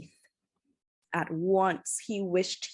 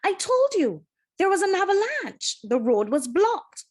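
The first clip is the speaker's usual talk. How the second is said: much higher and louder, defensive; exasperated driver; 10 semitones higher, 6 dB louder than usual. A narrator's reading. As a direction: fire back at the angry crowd. Driver defends himself to angry crowd.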